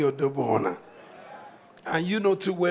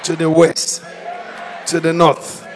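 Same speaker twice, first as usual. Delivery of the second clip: rising preacher's rally cry; rally speech; 4 dB louder than usual. Acted. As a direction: proclaim it to the whole church.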